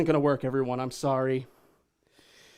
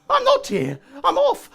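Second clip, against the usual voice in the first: flustered; 12 dB louder than usual. Acted. Flustered stranger caught out.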